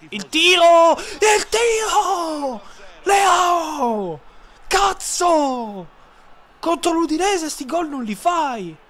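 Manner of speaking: rising yells of excitement; excited shouting. Real, not acted. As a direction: shout it as the shot goes in. Goal chance, shouting.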